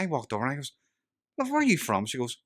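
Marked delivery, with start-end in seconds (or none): 1.4-2.1 acted high-pitched, louder disbelief; incredulous question. Storyteller to woman at Swiss bar, incredulous retold question. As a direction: ask in much higher, baffled mock amazement.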